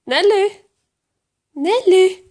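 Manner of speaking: high, insistent name call; high sing-out for a cat's attention. Real, not acted. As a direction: call a pet that ignores you, high-pitched. Calling the cat that ignores her.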